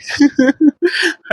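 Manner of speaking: high-pitched giggle. Acted. After a playful threat to the caller, high giggle. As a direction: burst into a high laugh.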